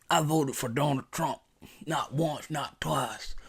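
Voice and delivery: low, soft, halting confession to an officer; humble country-boy act. Shown as a demonstration. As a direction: play the harmless country boy under questioning. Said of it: "do just like this".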